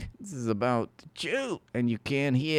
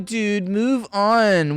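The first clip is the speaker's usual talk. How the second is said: mock chat voice; 9 semitones higher, 6 dB louder, much slower than usual. Mocking chat request.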